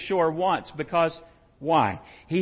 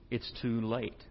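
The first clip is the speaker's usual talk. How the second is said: ordinary talk, nothing marked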